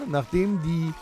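ordinary talk, nothing marked

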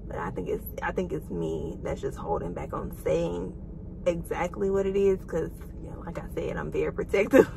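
soft, hushed confession; real soft whisper. Real, not acted. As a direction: keep it hushed like a secret. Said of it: "whispering secret plans".

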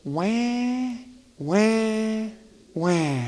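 soft mock baby cry wah wah; mock whining cry. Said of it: "we don't judge".

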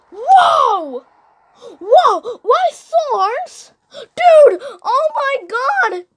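high, loud startled whoas; excited yelling. Real, not acted. Child reacting to the hyena picture, yelling excitedly. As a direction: shout it out in alarm.

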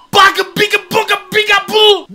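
high, loud goofy gibberish; loud high silly gibberish; silly nonsense. Pretend. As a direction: babble like a cartoon.

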